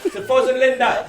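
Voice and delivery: loud, high laughing toast; toast shout. Real, not acted. Raising a toast to the couple.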